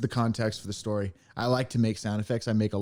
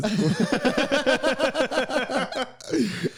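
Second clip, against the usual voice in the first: high laugh; 12 semitones higher than usual. Real, not acted. Laughing at two views.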